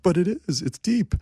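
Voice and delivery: high-pitched mock protest; pleading defence. Writer defends his lyrics to co-writers, high pleading.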